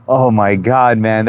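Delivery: normal talking voice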